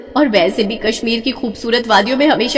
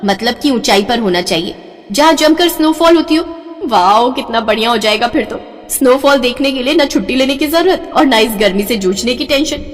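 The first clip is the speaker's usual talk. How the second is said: usual speaking voice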